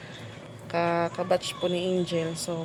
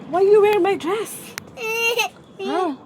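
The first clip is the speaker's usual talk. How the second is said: put-on higher-pitched teasing demand to a small child; playfully put-on indignation; mock indignant; 11 semitones higher, 5 dB louder than usual. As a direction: pretend to accuse a child over a dress. Teases crying child over her dress.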